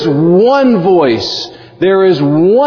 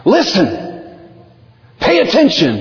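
higher, urgent call to listen; preacher urging the crowd to pay attention, exclaimed; exclaimed command; 5 semitones higher than usual. Shown as a demonstration. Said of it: "it probably has some kind of exclamation point".

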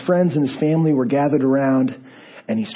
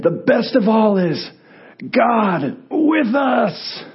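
higher, slow, ringing cry; triumphant proclamation; 9 semitones higher, 4 dB louder, much slower than usual. Acted. Dying preacher raises fist to comfort his friends, triumphant shout. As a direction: cry it out like a victory salute.

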